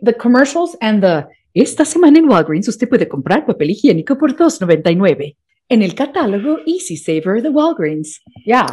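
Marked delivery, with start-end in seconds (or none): 1.5-8.1 polished Spanish radio ad read; lively retail spot delivery; commercial voiceover. Shown as a demonstration. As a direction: sell it like a store announcer in Spanish. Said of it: "I was the voice of Walgreens".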